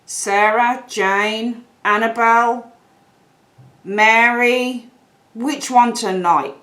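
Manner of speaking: slow, drawn-out names as if mulling choices; musing indecisively. Acted. Other person picking between sweethearts for tonight, musing indecisively. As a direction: weigh up each name slowly, one by one. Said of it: "there they are kind of saying".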